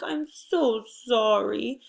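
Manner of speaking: drawn-out, hammed-up apologetic voice; sorry voice. Shown as a demonstration. Teacher showing first graders a sorry voice, slow and apologetic. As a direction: slowly plead a pretend apology. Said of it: "make a sorry voice".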